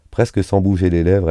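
usual speaking voice

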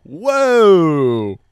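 loud surprised exclamation; shocked shout; startled whoa. Real, not acted. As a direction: blurt out in shock. Shocked by the kiss question.

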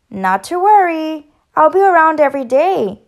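high, loud, chirpy reassurance; cheerful chirp. A narrator's reading. Bird teacher reassuring her new pupil, chirping cheerfully. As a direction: chirp it out brightly and loudly. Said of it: "chirped Bird".

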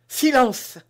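high, loud sudden alarm; startled hush. Acted. Constance to D'Artagnan, startled by voices outside. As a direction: jump and cut him off in fright. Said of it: "dit madame Bonacieux en tressaillant".

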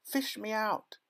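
put-on very high child's plea; high drowning-boy cry; panicked yell. A narrator's reading. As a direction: call desperately for rescue. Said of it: "he yelled".

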